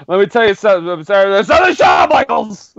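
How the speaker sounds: stumbling promo building to a yell; Diesel. Retold or imitated. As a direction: imitate a wrestler getting really loud. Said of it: "he just gets really loud".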